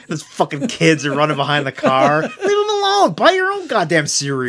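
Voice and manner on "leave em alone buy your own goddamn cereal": very high, loud, laughing leprechaun yell; angry leprechaun. Pretend. Leprechaun yelling at kids chasing the car, very high and laughing. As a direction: shoo the kids off like a cranky cartoon leprechaun. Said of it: "the Lucky Charms, uh, leprechaun".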